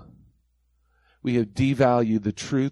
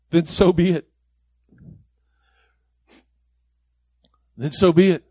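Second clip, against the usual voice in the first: louder with each repeat; building preacher's refrain; 3 semitones higher, 4 dB louder than usual. Acted. Preacher echoing Paul's surrender to the congregation, growing louder. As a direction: land the refrain harder each time.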